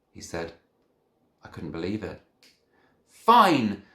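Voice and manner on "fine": very loud high bellow. A narrator's reading. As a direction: bellow the word out. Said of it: "I bellowed".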